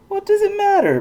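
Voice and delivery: high, loud sneering put-down. A narrator's reading. Bun Bun sneers at Poniella, high and loud. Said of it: "Bun Bun sneered".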